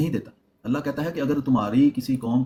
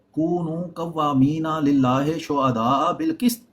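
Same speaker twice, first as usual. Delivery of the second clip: slow chanted recitation; Quran recitation; much slower than usual. Real, not acted. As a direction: chant the verse slowly. Quran verse recited slowly.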